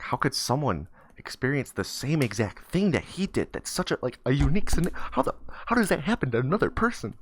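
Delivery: high, incredulous swoops; in shock. Acted. Boy reeling at a stranger's identical story, shocked. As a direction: puzzle over it in high-pitched disbelief. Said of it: "just in shock".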